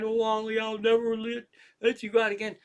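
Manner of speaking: high, sustained mock-doting wail; gushing over cat. Lady hugging her lost cat, mock gushing. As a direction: imitate an owner fussing over her pet. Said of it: "she's hugging the cat".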